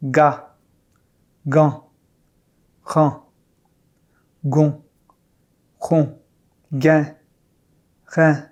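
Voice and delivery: ran, ron, rein with a French throat r; uvular r. Shown as a demonstration. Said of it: "R is at the beginning of a word".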